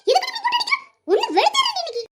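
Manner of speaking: high-pitched fighting protest; angry threat. Acted. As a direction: threaten her with the voice shooting up. Threat during a hair-pulling fight. (கோபமான மிரட்டல், உயர்ந்த குரல்)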